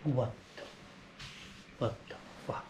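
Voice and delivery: breathless whisper of disbelief; barely voiced, stunned; stunned by the track's ending, a hushed whisper. Real, not acted. Stunned reaction, under breath.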